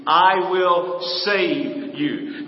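very slow, every word weighted; preacher lingers on God's promise, very slow; slow emphatic. Acted. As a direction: stretch it out deliberately.

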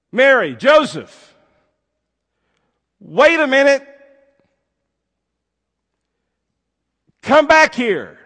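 high, loud, desperate call; calling after them. A narrator's reading. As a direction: shout after people walking away. Crying boy calls couple back.

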